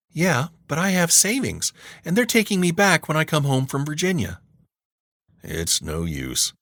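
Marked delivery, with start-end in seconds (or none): 0.1-4.4 put-on higher, confident girl voice; teen girl voice. A narrator's reading. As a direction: play the stubborn niece. Niece counters again.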